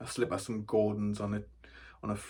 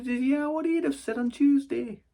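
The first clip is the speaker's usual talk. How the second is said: high-pitched nana voice; chatty nana; 14 semitones higher than usual. Acted. Grandchild mimicking nana who likes to talk, high chatty voice. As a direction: gossip away as nana, pitched way up. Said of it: "She likes to talk, my nana".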